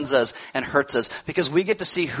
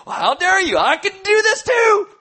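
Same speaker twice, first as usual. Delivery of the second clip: sarcastic outrage; 14 semitones higher, 4 dB louder than usual. Retold or imitated. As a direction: fire back like you've been insulted. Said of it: "Because I'm like".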